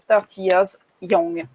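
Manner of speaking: put-on slow, careful English accent read of the ad line; deliberate English accent quoting the brand. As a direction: read the English tagline slowly with a French speaker's English accent. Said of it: "Pardon pour mon super accent".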